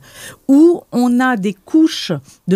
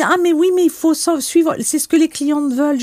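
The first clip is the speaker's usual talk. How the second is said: higher-pitched imitation of an objection; protesting colleague; 6 semitones higher than usual. Quoting her team's objection, higher-pitched protest. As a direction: mimic a colleague insisting, pitched up. Team member objecting, quoted.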